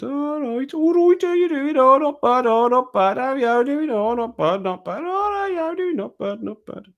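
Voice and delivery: very high, singsong mock Midland accent. Mocking own home accent, high hammed-up Midland. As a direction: squeal out a hammed-up Midland greeting. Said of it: "It's a Midland accent".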